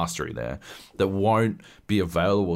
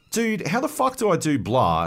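high, frustrated plea for help; exasperated question; 11 semitones higher, 3 dB louder than usual. Retold or imitated. Stuck gamer begging his brother for help, high and exasperated. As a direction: whine it at your brother, stuck.

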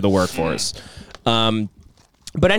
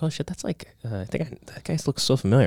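private realisation; 7 dB quieter than usual. Retold or imitated. Retold private realisation in elevator.

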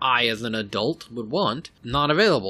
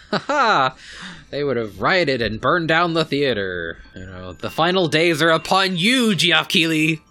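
loud, high hammed-up gamer threat; mock gamer rant; 5 semitones higher, 5 dB louder than usual. Joking about fans who would riot, a loud hammed-up rant. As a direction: ham up a cackling rioting fan, louder and higher.

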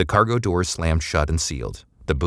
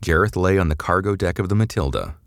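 ordinary delivery, nothing marked

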